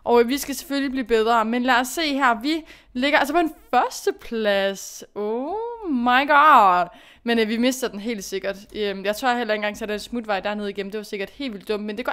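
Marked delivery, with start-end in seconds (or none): 5.1-6.9 slow, drawn-out stunned oh my god; amazed. Real, not acted. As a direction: stretch it out in disbelief. She's in first place.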